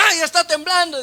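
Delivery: high, loud panicked cry of someone who feels the earth shake; mock frightened scream. Preacher acting a woman screaming in an earthquake, mock panic. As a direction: shriek it like a woman terrified of tremors, half-playful.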